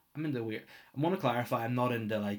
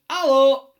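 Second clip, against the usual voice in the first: loud high greeting; 20 dB louder than usual. Pretend. Mugging in the Mona Lisa.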